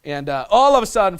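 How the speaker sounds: ordinary delivery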